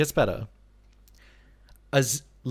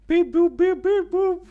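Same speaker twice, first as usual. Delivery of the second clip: high, loud sung phone beeps; mock dialing beeps; 7 dB louder, slower than usual. Streamer faking a phone call to HBO, high mock keypad beeps. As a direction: play the keypad with your voice.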